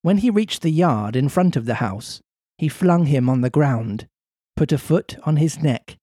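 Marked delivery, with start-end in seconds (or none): none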